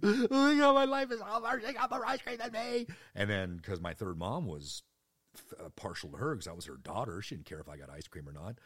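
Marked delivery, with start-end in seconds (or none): 0.0-2.9 put-on very high, loud whiny kid; hammed-up child melodrama. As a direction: wail like a six-year-old whose life is over. Child melodrama over ice cream.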